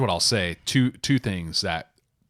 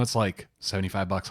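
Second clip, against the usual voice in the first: ordinary talk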